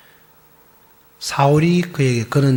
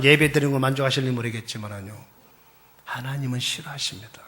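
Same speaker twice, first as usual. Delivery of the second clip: ordinary talk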